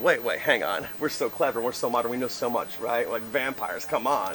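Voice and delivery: low, soft mocking disbelief; skeptical modern voice. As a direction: scoff quietly like a know-it-all. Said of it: "'cause we're like".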